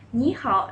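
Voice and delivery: "Ni hao" said crisply for peers; casual greeting. Shown as a demonstration. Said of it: "is for people like your peers".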